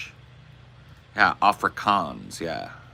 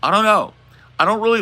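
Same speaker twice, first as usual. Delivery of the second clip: startled squeak; 6 dB louder, faster than usual. Real, not acted. Sudden high outburst.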